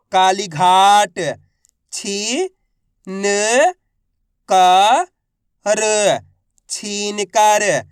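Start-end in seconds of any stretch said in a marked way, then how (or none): 1.9-6.3 राजनीतिक drawn out one syllable at a time. Shown as a demonstration. Teacher sounding out राजनीतिक for learners. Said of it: "तो मिलके बन जाएगा".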